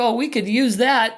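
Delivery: put-on a hopeful young husband's eager reply. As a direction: pounce on the idea. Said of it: "And I'm like".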